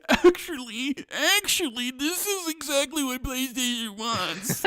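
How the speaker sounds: high, slow whine through laughter; whiny fanboy voice. Acted. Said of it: "whiny fanboy voice comes out".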